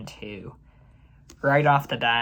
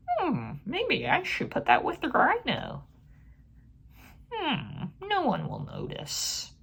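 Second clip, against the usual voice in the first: high, sneaky scheming director voice; mock Mark Webb; 14 semitones higher than usual. Reviewer mocking the director's choices, in a high sneaky scheming voice. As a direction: scheme aloud like a director hoping nobody notices. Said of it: "Mark Webb's just like".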